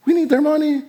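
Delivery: high mock excuse, nearly squeaky; a cheeky wheedling excuse voiced high. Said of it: "we're not gonna just be like".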